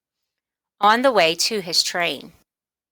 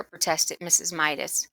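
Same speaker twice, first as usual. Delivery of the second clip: ordinary delivery, nothing marked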